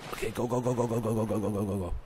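hushed, tense urging; urgent hushed go. Real, not acted. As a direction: rush it under your breath. Fleeing the shark underwater.

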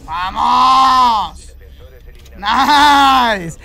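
loud triumphant yell after winning; excited shout. Real, not acted. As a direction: roar with real victory joy. Round won in a tense fight.

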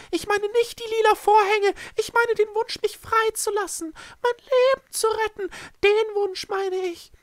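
very high, loud frantic protest; voice cracking upward. A narrator's reading. Echo pleads for his life, voice cracking. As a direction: shriek the correction in a high creature voice. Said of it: "Echos Stimme überschlug sich".

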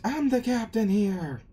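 high-pitched outburst; indignant protest. Retold or imitated. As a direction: insist on your rank, pitched right up. Luffy protests losing his captaincy.

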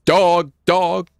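lightly imitating an overheard man's call; imitated dude's voice. Player telling friend what the mystery voice said, imitated dude's voice. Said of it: "That was a dude's voice".

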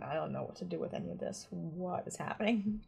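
bewildered mutter. Retold or imitated. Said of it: "kinda watching this going".

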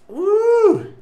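high, loud whoop of excitement; excited woo. Real, not acted. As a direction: cheer yourself on before the challenge. Hyping himself up for chip.

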